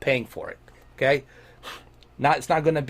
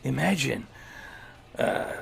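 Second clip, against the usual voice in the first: a lightly voiced, hushed, lower action-hero growl; Solid Snake voice; 3 semitones lower, 5 dB quieter than usual. Reading a viewer's question as a hushed soldier hero. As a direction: read the viewer's question like a tired covert soldier, half-hearted. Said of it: "in a Solid Snake voice".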